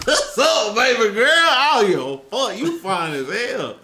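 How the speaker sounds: high, loud mock pickup line; hammed-up flirt. Calling out to the woman on screen, hammed-up flirting. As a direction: holler at her like a playful flirt.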